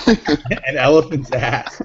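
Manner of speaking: slow, through laughter; laughing. Real, not acted. Host repeating a contestant's silly peanut answer, laughing. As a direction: crack up while saying it.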